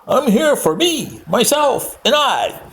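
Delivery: a mock Trump impression; imitating Trump. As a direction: send up his self-centred boast. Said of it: "And that's the way he says it".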